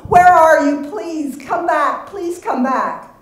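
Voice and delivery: high, loud, slow pleading call; mother crying out to her lost daughter; desperate calling. Acted. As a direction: beg her to come back across the street. Calling after Devon in street.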